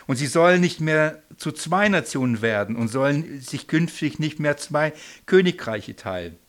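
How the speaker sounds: a loud, solemn Bible reading; scripture reading. Acted. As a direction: proclaim the verse to the room. Reads scripture aloud.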